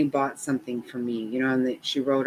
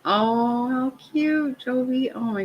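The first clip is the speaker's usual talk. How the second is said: cooing; 11 semitones higher, slower than usual. Real, not acted. Cooing at granddaughter's photo.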